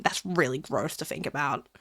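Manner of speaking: ordinary talk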